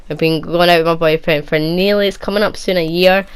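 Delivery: ordinary delivery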